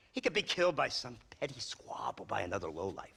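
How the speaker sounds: usual speaking voice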